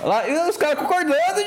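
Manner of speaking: clipping distorted mic. Real, not acted. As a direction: shout until the mic clips. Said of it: "Acho que tá estourando o áudio".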